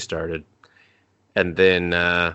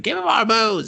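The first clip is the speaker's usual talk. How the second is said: much higher, squeakier plea for booze; higher mock drunk demand. Drunk chip begging its host again, squeakier. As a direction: push the tipsy chip's begging up high.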